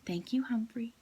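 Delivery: soft whispered thank-you; wife quietly thanks the hamster. A narrator's reading. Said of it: "quietly said".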